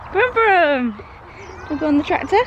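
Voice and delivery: high toy-engine coo; playful baby talk. Pretend. Mum offering toddler a tractor ride, high playful coo. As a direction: pitch it up, play at engines.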